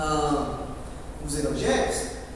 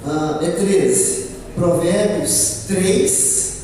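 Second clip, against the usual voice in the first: tongue-tied lisp; 6 semitones lower, much slower than usual. Real, not acted. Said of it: "com a língua bem presa".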